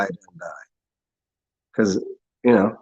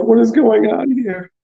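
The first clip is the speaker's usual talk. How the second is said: high, slow, exasperated wail; retold baffled whine, pitched up; mock bewilderment; 9 semitones higher, 3 dB louder, slower than usual. Drinker baffled by sad music, high-pitched and exasperated. Acting out own weepy bewilderment.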